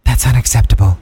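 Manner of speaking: low, loud put-on character voice; Mutilator. Mascot butting in to judge the restaurant, low loud verdict. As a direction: drop it low and push it out loud. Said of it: "the mutilator wants to say something".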